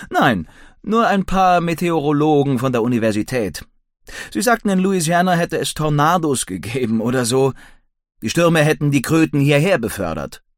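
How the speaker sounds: lightly voiced animated young man; Billy. A narrator's reading.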